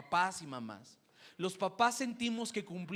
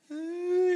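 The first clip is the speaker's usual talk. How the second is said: mock crying wail; 11 semitones higher, 8 dB louder than usual. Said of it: "empezó a llorar".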